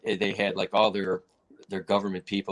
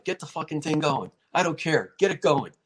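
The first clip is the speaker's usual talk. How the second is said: imitating an impatient fighter, higher pitch; irritated retold demand, pitched up; pissed off; 5 semitones higher, 3 dB louder than usual. Fighter brushing off the referee, impatient and pissed off. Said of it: "Jose was really pissed off".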